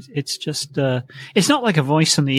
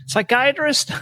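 very high, incredulous mishearing; deaf old father; 12 semitones higher, slower than usual. Retold or imitated. Deaf host's father mishearing a second time, high and baffled. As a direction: imitate a baffled, hard-of-hearing man. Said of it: "he was deaf".

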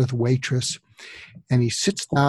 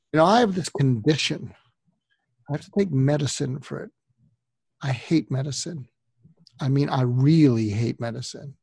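normal talking voice

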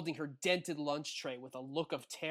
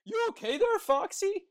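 high squawky goose voice, much higher and louder; 10 dB louder than usual. Acted. Goosey voiced in read story.

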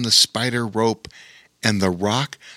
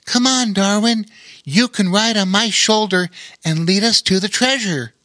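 higher, eager invitation; piping up; 9 semitones higher, 3 dB louder than usual. Acted. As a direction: chirp up keen to go. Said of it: "Rowan piped up".

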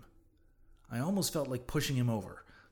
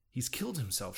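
ordinary delivery

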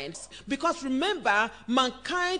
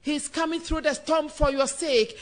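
ordinary talk

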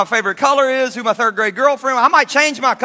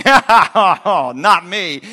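loud laughing denial; 7 dB louder, much slower than usual. Acted. Peter boasting denial, laughing.

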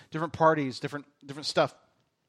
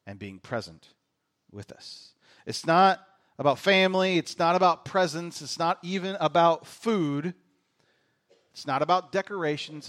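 ordinary talk, nothing marked

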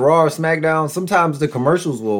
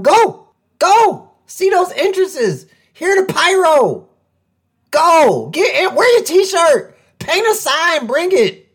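hyped urging; 11 semitones higher, slower than usual. Real, not acted. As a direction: fire the fans up to go. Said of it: "urging fans to go".

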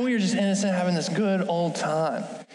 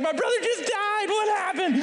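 high-pitched frantic child, played for laughs; mock panic; 11 semitones higher than usual. Kid convinced the brother is dead, comic panic. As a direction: squeal it in exaggerated alarm.

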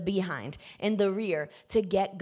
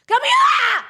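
full shout, far higher and much louder; yelling; 11 dB louder than usual. Retold or imitated. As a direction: bellow the name across the house. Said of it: "Until I am quite literally yelling".

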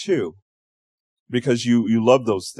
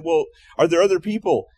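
rescuer's question; 6 semitones higher, 4 dB louder than usual. Acted.

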